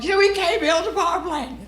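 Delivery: high-pitched whiny imitation of crying; mock crying. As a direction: whine it like a tearful heckler. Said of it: "Is she crying?".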